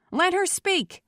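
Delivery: high, commanding cry; shout to crowd. A narrator's reading. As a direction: shout down an angry mob. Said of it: "he shouted to the crowd".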